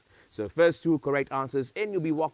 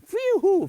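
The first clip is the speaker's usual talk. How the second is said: relieved phew; 9 dB louder than usual. Real, not acted. Relief after dog runs off.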